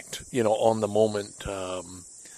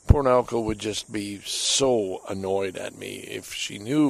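ordinary talk

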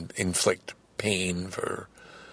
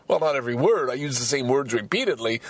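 ordinary talk

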